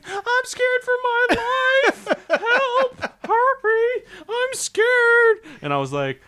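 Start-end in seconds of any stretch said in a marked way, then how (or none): 0.1-2.1 very high, drawn-out mock shriek; slow, squealing fake panic; freaking out. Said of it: "fucking freaking out". 2.3-5.4 very high, loud mock shrieking; piercing fake panic; freaking out. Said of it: "fucking freaking out".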